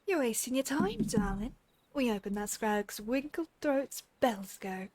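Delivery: put-on lightly done rough British pirate accent; a sneering pirate voice in a shaky British accent; amateur British accent reading a pirate villain; attempted British accent. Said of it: "I apologize in advance for the accent".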